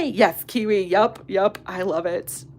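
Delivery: ordinary talk, nothing marked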